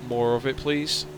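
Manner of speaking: ordinary talk, nothing marked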